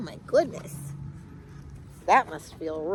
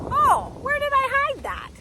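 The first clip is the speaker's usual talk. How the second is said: 1 forgetful squirrel; 4 dB louder than usual. Acted. Squirrel forgets hiding spot.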